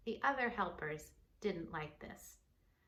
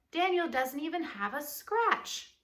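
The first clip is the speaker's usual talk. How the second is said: higher, louder delighted king; amazed; 6 semitones higher, 5 dB louder than usual. Acted. King marvelling that Daniel has no scratch. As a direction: marvel out loud.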